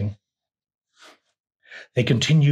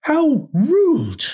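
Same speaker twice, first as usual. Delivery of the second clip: very high, louder offended exclamation; high-pitched affront; 14 semitones higher, 5 dB louder than usual. A narrator's reading. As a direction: squeak with indignation in character. Insulted character exclaims in story.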